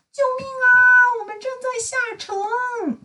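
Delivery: high panicked shout, then tearful wail; cry for help. A narrator's reading. Little Miss Dotty panicking as the submarine dives, crying out. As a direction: call out in alarm as it sinks. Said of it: "古怪小姐大声叫道".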